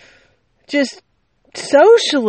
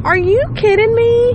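disbelief; 12 semitones higher, 3 dB louder than usual. Real, not acted. Outraged at kid's rude reply.

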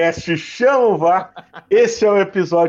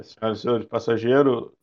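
low, measured airline captain announcement; pilot over the radio; 6 semitones lower than usual. Retold or imitated. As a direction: announce to the cabin like a captain, pausing. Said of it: "comandante de avião, ele tem sempre uma pausa".

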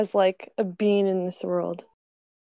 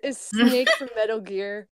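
usual speaking voice

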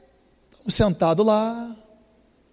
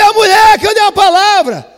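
high, loud greeting across the room; excited calling out; 14 dB louder, slower than usual. Retold or imitated. Preacher shouting a greeting to the flight attendant. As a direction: call her over joyfully.

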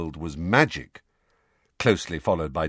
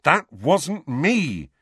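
firm denial; 8 semitones higher, 4 dB louder, much slower than usual. A narrator's reading. Harry denying, measured.

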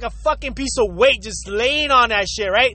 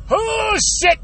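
high, startled yelp of panic; sudden alarm; 9 semitones higher than usual. Retold or imitated. Driver caught off guard by his bladder, startled yelp. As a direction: blurt it out as the urge hits.

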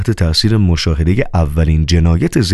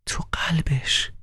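sorrowful whisper; 10 semitones higher, 11 dB quieter, slower than usual. Acted. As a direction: whisper «تو قلبش» slowly, voiceless and grieving. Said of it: "و آنگاه با اندوه تکرار کرد". (نجوای غمگین و آهسته)

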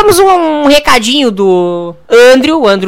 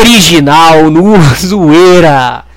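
low, very slow station-ident voice; deep jingle voice; 8 semitones lower, 4 dB louder, much slower than usual. Acted. Show drops its slogan in a deep slow ident voice. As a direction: boom out the tagline like a jingle.

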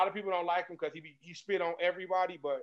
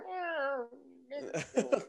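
high, soft, disapproving hum; disapproving grandma; 7 semitones higher, 8 dB quieter than usual. Retold or imitated.

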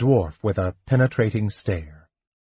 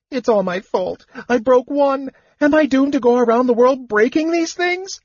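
much higher, wailing kender voice; a small kender wailing in despair. A narrator's reading. Kender bursts into tears before his friends, high wailing. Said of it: "Tas wailed incoherently".

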